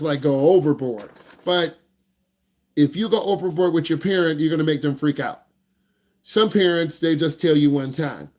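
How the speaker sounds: usual speaking voice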